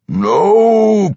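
loud, high, drawn-out growled refusal; long angry cry; growling. A narrator's reading. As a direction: growl it out in furious protest. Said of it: "Pwent growled".

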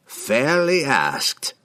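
very slow, high and swooping; theatrical drawn-out wizard; conceding wizard. A narrator's reading. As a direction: concede grandly, stretching both words. Wizard concedes the point grandly.

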